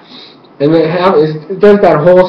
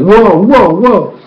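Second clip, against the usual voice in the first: raised, repeated "whoa"; astonished reaction; 4 semitones higher, slower than usual. Retold or imitated. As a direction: say it amazed, pitched up. Acts out her reaction.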